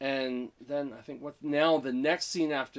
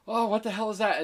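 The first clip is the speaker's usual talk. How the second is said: higher-pitched startled exclamation; retold alarm, pitched up; mock freak-out; 8 semitones higher, 4 dB louder than usual. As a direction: act out a shocked girlfriend. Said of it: "freaking out more".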